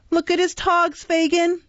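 high cheeky urchin voice; Charlie Bates. Acted. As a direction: crow with delight at the togs. Charlie's excited jeering voice.

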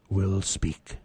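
normal talking voice

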